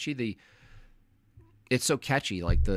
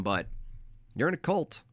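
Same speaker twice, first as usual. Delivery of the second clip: ordinary talk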